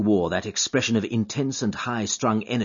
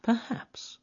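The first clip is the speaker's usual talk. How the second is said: higher, softer, slower echo; thoughtful; 6 dB quieter than usual. Acted. Holmes repeating to himself, thoughtful and soft. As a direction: drift off into thought. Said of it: "said Holmes, thoughtfully".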